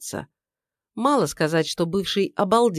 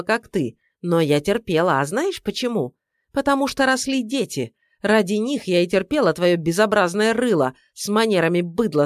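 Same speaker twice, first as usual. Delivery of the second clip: angry tirade; 3 semitones higher than usual. Acted. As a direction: lay into him furiously.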